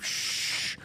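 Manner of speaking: soft unvoiced shush, as if putting someone to sleep; mock shushing. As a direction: hush them down gently.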